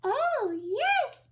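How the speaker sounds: very high piping little voice; piped. Acted. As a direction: chirp it up high and tiny. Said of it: "piped the voice".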